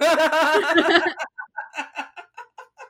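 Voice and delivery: loud, high, long burst of laughter; real sustained cackling laugh; big laugh. Real, not acted. Storyteller after finally arriving, big real laugh.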